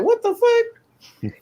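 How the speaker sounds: very high, slow, drawn-out mock outrage; squealed slow outrage; stunned disbelief. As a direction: stretch it out in hammed-up shock. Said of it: "They're like".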